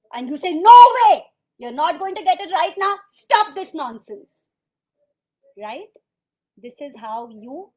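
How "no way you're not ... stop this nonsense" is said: explosive high shout, then stern; angry parent. Acted. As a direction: act a parent yelling at a child. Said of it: "And you get angry".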